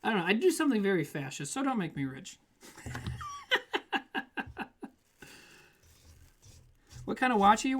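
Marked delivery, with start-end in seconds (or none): 2.7-4.9 long high wheezy laugh; helpless chuckle at your own joke; real laugh. Real, not acted. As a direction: laugh in a run of high bursts.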